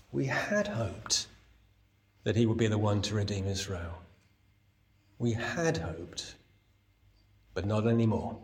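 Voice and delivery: mild sad. Retold or imitated. Disciples' lost hope, quoted.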